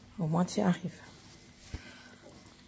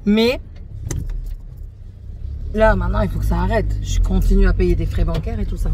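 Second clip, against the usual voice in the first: louder, heated complaint; angry retelling; 3 dB louder than usual. Retold or imitated. Retelling her angry call to the bank, heated and loud. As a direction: vent it like the call still stings. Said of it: "Je me suis énervée".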